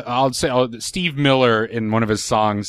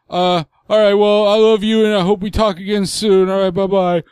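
dad voice; 8 semitones higher, 3 dB louder than usual. Retold or imitated.

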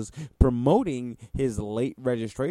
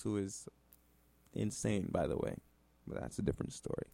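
much softer, dropping to a whisper; quiet aside; 3 semitones lower, 11 dB quieter, faster than usual. Real, not acted. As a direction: mutter the aside under your breath.

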